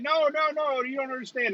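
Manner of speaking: high-pitched, pleading protest; retold protest. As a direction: cut in high, trying to explain.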